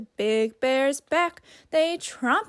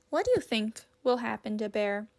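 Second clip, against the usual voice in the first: ordinary delivery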